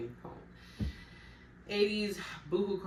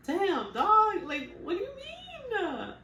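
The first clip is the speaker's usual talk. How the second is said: incredulous outburst; 5 dB louder, much slower than usual. Real, not acted. Outraged at Daniel's turnaround.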